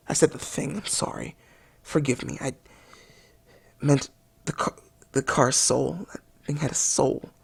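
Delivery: hushed, low, halting mock mourning; softly confess, acting overcome with grief; grieving apology. Reviewer begs forgiveness for calling a car a thing, hushed grief.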